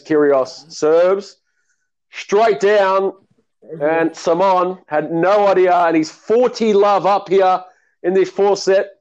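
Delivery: mock TV tennis commentary; play-by-play commentary. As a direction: call the point like a TV commentator. Live play-by-play call.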